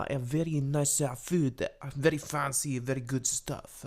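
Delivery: put-on loud, hammy French accent; over-the-top French accent, bragging. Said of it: "I feel like I'm not good at doing a French accent".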